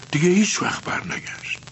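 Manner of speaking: whispered, barely voiced; whispered grief. Boy telling his teacher his father never came back, whispered. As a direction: let the words fall to a whisper.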